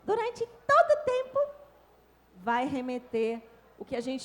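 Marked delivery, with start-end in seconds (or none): 0.0-1.4 squeaky high demo voice held through the phrase. Shown as a demonstration. Teacher showing the class a shrill high tone. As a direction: pitch way up like talking to a baby. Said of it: "tom bem agudo".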